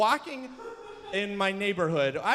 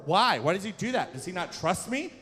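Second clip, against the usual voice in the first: ordinary delivery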